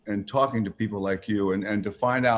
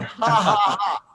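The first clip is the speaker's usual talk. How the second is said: high, louder gloating laugh; mock triumphant laugh; 8 semitones higher, 4 dB louder, slower than usual. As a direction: laugh like you just won.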